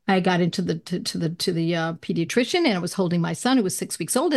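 usual speaking voice

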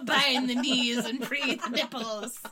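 loud, slowed, mock-witchy chant; spell incantation. Friend jokingly casts a nipple-freeing spell, mock incantation. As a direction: proclaim a spell like a ceremony. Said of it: "It was like".

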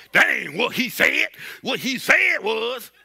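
put-on sassy arguer, the second line slowed; mock a churchgoer bickering over scripture; mock argumentative voice. Preacher mocks believers arguing over Jesus, put-on sassy voice. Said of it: "I don't know why I did that".

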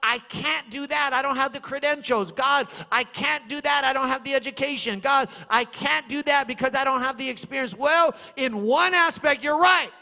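loud, high, fervent preacher's cry; shouting praise from the pulpit; full-force preaching. Acted. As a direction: preach it out to the whole church. Preacher rising again.

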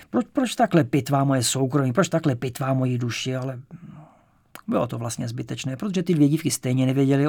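plain reading voice

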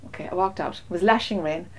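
ordinary talk, nothing marked

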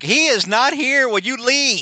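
high, exasperated superhero; Spidey. Retold or imitated. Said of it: "and Spidey's like".

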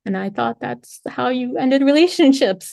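ordinary talk, nothing marked

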